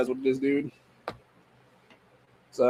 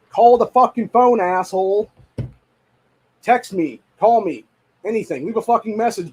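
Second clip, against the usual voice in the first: louder, slower, higher venting; exasperated rant; 7 semitones higher, 4 dB louder, slower than usual. Real, not acted. To the sign guy, a loud exasperated rant. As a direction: demand they just call first.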